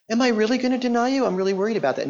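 high, quick, anxious what-if; mock worried Peter. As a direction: play a fretting disciple, hammed up. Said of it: "No, he says".

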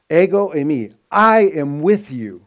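"Ego" and "I" pitched high and loud; emphatic I. Retold or imitated. Teacher voices Jesus assuring the disciples, emphatic "Ego" and "I". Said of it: "he was putting emphasis in his presence".

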